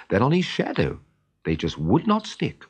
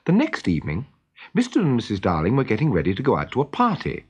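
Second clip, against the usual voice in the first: ordinary delivery, nothing marked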